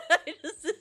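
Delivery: laughing. Real, not acted. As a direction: laugh through it while trying to talk. Laughing while speaking.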